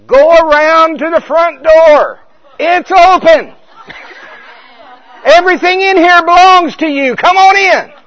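loud high call, slowly, to the front door; yelled. Retold or imitated. Spirit calling out to the soul, a slow loud high yell. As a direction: shout the invitation across the house. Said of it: "And I yelled".

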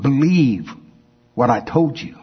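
ordinary talk

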